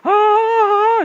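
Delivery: long high loud "Ah" from the belly; belly-voice shout. Shown as a demonstration. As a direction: bellow "Ah" as voice training. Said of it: "enak dilatihan di pantai atau di gunung". (teriakan "Ah" panjang dari perut)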